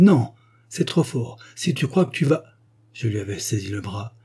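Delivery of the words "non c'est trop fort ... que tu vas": angry outburst. Acted. Jean refusing the woman who begs him to keep her, angry outburst. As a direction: explode at her, stamping his foot. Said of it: "Il frappa du pied".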